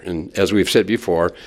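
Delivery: ordinary talk, nothing marked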